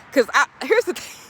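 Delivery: normal talking voice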